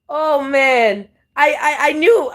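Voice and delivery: usual speaking voice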